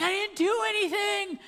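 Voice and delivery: put-on high whiny child's protest; mock sobbing denial. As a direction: imitate a tearful kid denying it. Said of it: "like, sobbing".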